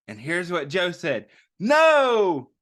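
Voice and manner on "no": high, loud cry of protest; shouted no. A narrator's reading. Reading to children, Joe shouts at the kids. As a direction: shout it out in frustration. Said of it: "shouted Joe".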